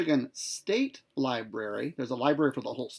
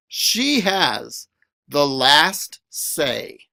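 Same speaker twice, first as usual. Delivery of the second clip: lively, sing-song picture-book reading; reading to children; 6 semitones higher, 11 dB louder, much slower than usual. A narrator's reading. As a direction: read it aloud to a class, playful and bouncy.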